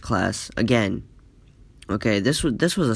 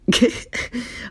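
a breathy high giggle; high laugh; 12 semitones higher than usual. Real, not acted. Recalling A J's blank face, a high breathy giggle. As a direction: giggle helplessly at the memory.

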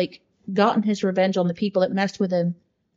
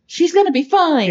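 much higher mock-hopeful voice; imitating hopeful reaction; 9 semitones higher, 4 dB louder than usual. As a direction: mimic a friend's naive reassurance, pitched up high. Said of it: "you were like".

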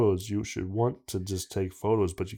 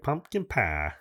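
put-on Southern accent; 5 dB quieter, slower than usual. Friend showing how the mystery guy said it, Southern accent. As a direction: mimic a Southerner saying it. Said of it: "a Southern accent".

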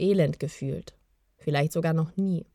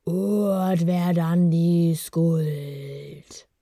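very slow, louder whispered incantation; sonorous disembodied voice; 5 dB louder, much slower than usual. A narrator's reading. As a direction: chant it slowly and forcefully, like a bodiless voice filling the stone. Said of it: "vom sonoren Klang der körperlosen Stimme".

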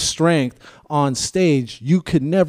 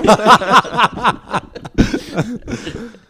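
high loud burst of laughter; roaring laugh at the joke; big laugh; 10 semitones higher, 6 dB louder than usual. Real, not acted. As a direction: laugh hard. Laughs at the yelling.

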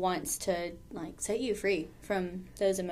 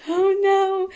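dread, pitched way up; high panicked oh no; 12 semitones higher, 9 dB louder than usual. Retold or imitated. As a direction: squeak it out in alarm. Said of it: "I was like".

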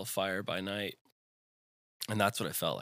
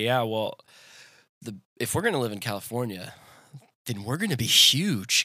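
cocky younger self; 7 semitones higher, 9 dB louder than usual. Retold or imitated. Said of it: "And I would always just say".

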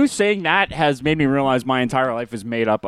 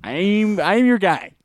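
high mock-helpful voice; hammed-up people-pleasing; fake eagerness; 8 semitones higher, slower than usual. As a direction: pitch it up, overly agreeable. Acting out fake agreement.

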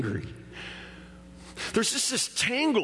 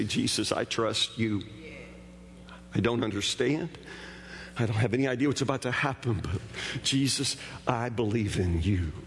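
quiet trusting prayer; 10 semitones lower, 4 dB quieter than usual. Acted. Voicing a believer's prayer.

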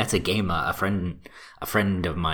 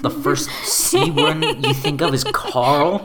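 higher, louder laughing question; 9 semitones higher, 6 dB louder than usual. Real, not acted. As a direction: tease through laughter. Teasing friend about name.

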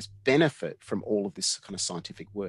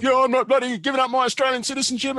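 high, loud retold rant; acted-out indignant outburst; 14 semitones higher, 8 dB louder, faster than usual. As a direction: go off at him, re-enacted. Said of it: "I went off at him".

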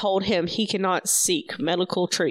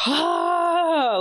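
long, high-pitched exasperated "ahh"; exasperated sigh; 10 semitones higher than usual. Real, not acted. Host summing up the chapter, exasperated sigh. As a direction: let out a drawn-out high wail of frustration.

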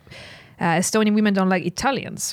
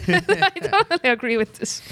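laughing; 9 semitones higher than usual. Real, not acted. Laughing agreement.

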